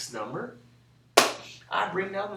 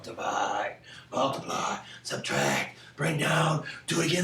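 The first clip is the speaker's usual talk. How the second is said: low sleep-talk growing less hushed; sleepwalker mumbling; 3 semitones lower than usual. Acted. Sleepwalking child repeating the steps, mumbled sleep-talk. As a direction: chant it as if asleep, building. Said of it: "first sleepwalking lesson".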